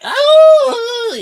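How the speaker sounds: put-on a loud childish jeering yelp; kids taunting. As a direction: squeal it like a kid mocking in the playground. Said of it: "bloody little kids in school where they're like".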